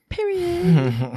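squeaky falsetto period; high squeaky voice. Pretend. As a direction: pitch it way up and squeak.

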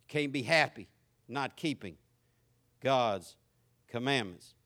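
ordinary talk

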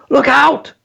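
high-pitched, urgent cry of alarm; sudden high warning shout; yelled warning. A narrator's reading. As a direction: yell it sharply to warn someone of danger. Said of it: "she yelled".

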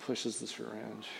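ordinary talk, nothing marked

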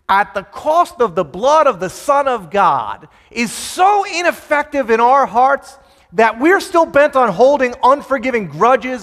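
loud, emphatic sermon build; preaching at full force. Acted. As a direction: drive it home to the congregation.

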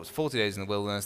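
normal talking voice

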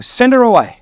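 high-pitched, acted appeal; begging. A narrator's reading. Disciples beg Jesus about the woman, pleading. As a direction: plead with a sudden lift in pitch. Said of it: "came and begged Him".

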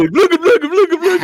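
high, silly repeated chatter; fake man bobbing. Soldiers waggle a decoy at a sniper, silly high chatter. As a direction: chant it like a waggled puppet. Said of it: "they're making a fake man go".

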